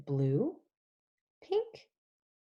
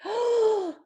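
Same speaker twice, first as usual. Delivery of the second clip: gasp; 14 semitones higher, 5 dB louder than usual. Acted. Reading Good Night Gorilla, surprise.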